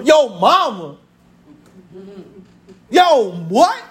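usual speaking voice

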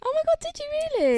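high excited squeal. Real, not acted. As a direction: squeal it with delight.

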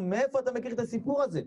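high, wondering query; astonished question. Retold or imitated. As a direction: ask in amazed disbelief. Mourning children question the young storyteller. (שאלה נדהמת)

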